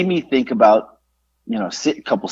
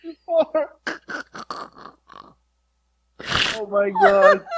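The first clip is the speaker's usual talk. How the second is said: much higher, softer, breaking with laughter; laughing; 8 dB quieter than usual. Real, not acted. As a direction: gasp the words out, still laughing. Still laughing after joke.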